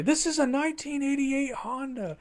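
high-pitched, indignant impression; imitated outrage. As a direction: quote him in a high, put-on voice. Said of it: "what did he say?".

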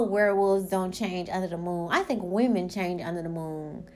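usual speaking voice

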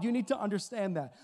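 ordinary talk, nothing marked

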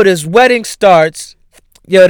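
ordinary talk

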